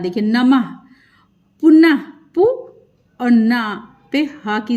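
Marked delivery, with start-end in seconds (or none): 1.6-2.4 पुनः said loudly, ending on a breathy ह, then पु alone; visarga demo. Shown as a demonstration. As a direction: break पुनः apart, with a clear ह at the end. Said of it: "आह का sound आ रहा है ना". (पुनः ज़ोर से, अंत में ह की आवाज़)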